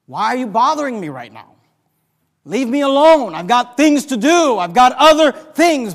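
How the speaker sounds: loud, high-pitched exasperated parent; frustrated parent. Acted. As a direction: push the child away in frustration. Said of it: "get so frustrated to act".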